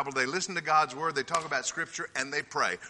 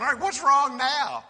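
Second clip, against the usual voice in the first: exasperated; 9 semitones higher, 4 dB louder than usual. Retold or imitated. Exasperated workers at clinic.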